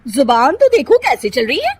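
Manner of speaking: loud, high, mocking threat; angry threatening sister-in-law. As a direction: put the newcomer in her place. Kirti threatens Nisha. (धमकाती Jethani)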